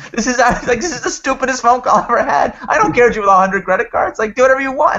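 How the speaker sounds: acted tirade, high and loud, building to a peak; exasperated rant. Lawyer scolding the team over a pointless call, acted exasperation. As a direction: berate them as the fed-up lawyer.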